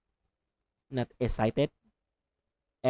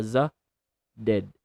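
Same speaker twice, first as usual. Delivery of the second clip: normal talking voice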